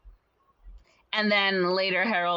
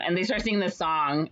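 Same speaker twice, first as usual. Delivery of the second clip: ordinary talk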